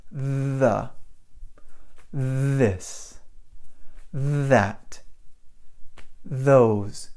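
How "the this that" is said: The, this, that with a buzzing voiced th at the start; voiced th words. Shown as a demonstration. Teacher models voiced th words for students. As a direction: buzz the th in The, this, that. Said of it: "It's always the voiced sound".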